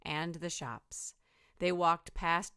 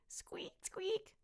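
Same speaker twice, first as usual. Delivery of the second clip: high whispered squeaks; 7 dB quieter than usual. Acted. Skeleton animals at the zoo.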